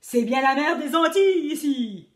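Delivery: lightly voiced pirate exclaiming loudly. A narrator's reading. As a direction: bellow the question like a salty pirate. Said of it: "s'est écrié le pirate".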